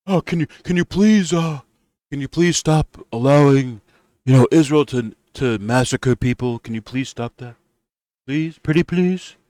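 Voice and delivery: high, whiny, begging mock voice; mock pleading whine. Bernie begging Trump to stop Israel, in a high whiny plea. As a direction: grovel like a helpless kid asking nicely.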